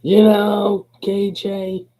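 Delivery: high, loud mock-mother voice; imitating his mama. Mama addressing K J, high-pitched imitation. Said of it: "Mama was like".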